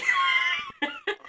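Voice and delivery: high shrieking laugh. Real, not acted. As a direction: burst into a squealing laugh. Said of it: "you were laughing".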